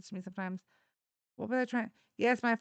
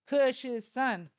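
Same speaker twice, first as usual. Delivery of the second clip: normal talking voice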